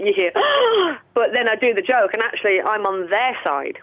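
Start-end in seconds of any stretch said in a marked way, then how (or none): 0.4-1.1 sharp high audience gasp; shocked gasp. Retold or imitated. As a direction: gasp in mock horror. Audience reaction imitated.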